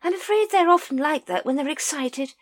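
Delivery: high, hearty upper-class lady; lady explaining her dragons, high and hearty; grand lady voice. A narrator's reading. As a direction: explain it like a fond breeder.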